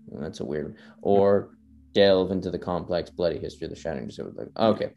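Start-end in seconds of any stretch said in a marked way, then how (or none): 1.0-4.1 grand, measured trailer voice, slowing into the last words; epic trailer narration. Acted. Podcaster reading the Elden Ring trailer aloud, epic narration. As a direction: narrate it like a fantasy game trailer.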